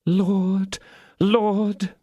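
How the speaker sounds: high, sweet cry to heaven; angelic plea. Acted. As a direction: lift it up like a saint. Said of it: "with an angelic sweetness".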